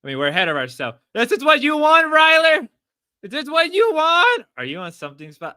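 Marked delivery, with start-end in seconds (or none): 1.1-4.4 loud, very high squeal; mock taunting. Streamer taunting Ryler on screen, mock squeal. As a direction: taunt him in a loud falsetto.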